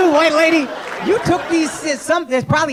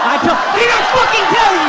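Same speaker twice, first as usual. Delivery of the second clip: loud, high furious yell at a heckler; angry shout; 12 semitones higher, 6 dB louder than usual. Real, not acted. Comic scolding a crowd member on his phone, loud yell. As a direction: blast him for being on his phone.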